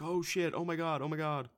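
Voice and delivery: oh-my-God panic, played up; mock horror. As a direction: react as if it keeps getting worse. Acted shocked viewer reaction.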